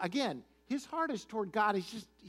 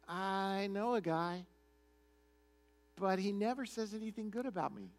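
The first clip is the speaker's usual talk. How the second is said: mock reluctance; 2 semitones higher, 4 dB quieter than usual. Ahab admits to Jehoshaphat there is one prophet, mock reluctant. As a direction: lightly imitate a sulky king giving in.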